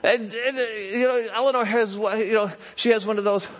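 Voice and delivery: worked-up storytelling at high pitch; excited, high-pitched sermon anecdote; animated preaching. Real, not acted.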